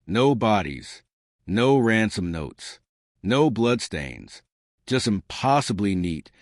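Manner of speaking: usual speaking voice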